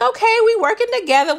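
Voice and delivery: high-pitched, quick "okay, we working together"; quick high reaction. Retold or imitated. Reacting to the little plan, quick high excitement. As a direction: rush it out high and pleased with the plan.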